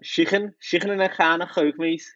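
high, throaty mock Scouse; Liverpool accent. Acting a Liverpool customer ordering in a shop, mock Scouse accent. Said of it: "do a Liverpool accent".

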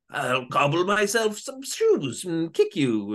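slow, high mock villain voice. Host pitching a shoe villain, gloating slowly in a put-on voice. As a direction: gloat like a cartoon cobbler villain. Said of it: "the mad shoer".

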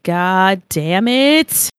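slow, drawn-out annoyed curse; exasperated groan. Retold or imitated. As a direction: drag it out, fed up. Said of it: "I literally said it just like that too".

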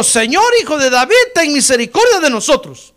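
loud, high plea in preaching voice; crying out. Retold or imitated. Two blind men calling to passing Jesus, crying out. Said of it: "gritaron diciendo".

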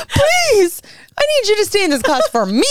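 high, fast pleading in a put-on Boston voice; mock Ben Affleck plea. Mother mocking Ben Affleck's plea to her son, high and fast. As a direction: beg him like a movie character, hammed up. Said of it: "You're Ben, Ben Affleck".

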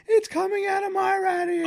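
very high, panicked shriek; Juliana. Retold or imitated. Daughter running from her bedroom, high panicked shout. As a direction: imitate a girl crying out in alarm. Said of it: "flipping out. Juliana comes running out".

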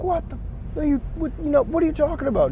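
high-pitched incredulous protest; mock outraged player arguing back; angry captain. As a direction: imitate an indignant captain, voice shooting up. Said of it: "he was very angry, obviously".

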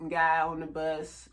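normal talking voice

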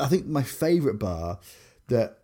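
ordinary talk

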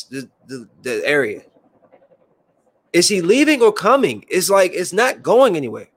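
fed up, tell the helicopter to go away; annoyed. Real, not acted. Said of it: "when I'm tired, I'm annoyed".

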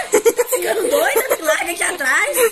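high, loud laughing protest; alarmed laughing yell. Real, not acted. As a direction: yell at her for leaving you behind. Friend left behind, alarmed. (gritando rindo, assustada)

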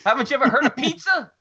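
high, loud quoted line; hammed-up delivery of the line; movie line impression.

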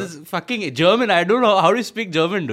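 sudden Bangalore lilt; South Indian accent. Real, not acted. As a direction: slip into South Indian English. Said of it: "Why you sound like you were in Bangalore".

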